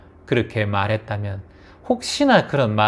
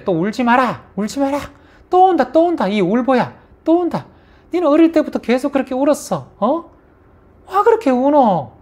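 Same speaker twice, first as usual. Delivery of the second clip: shrill high mocking yell at a crying child, louder; scolding mother; 13 semitones higher, 4 dB louder than usual. Mother yelling at her crying child, mocking scold. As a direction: scold the crybaby in a much higher, harsher voice. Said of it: "우리가 아이들 울 때".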